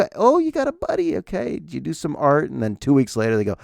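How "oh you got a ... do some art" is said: hammed-up high singsong parent voice; mock-interested parent. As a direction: play the parent feigning interest, pitched way up. Mocking parent's fake interest.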